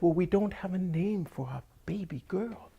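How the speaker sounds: high-pitched farmer voice; Saeed pleading his case. Acted. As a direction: explain earnestly in a high voice. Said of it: "And he said".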